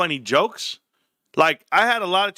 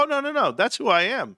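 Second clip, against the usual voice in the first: mock protest; 6 semitones higher than usual. Retold protest to corporate caller.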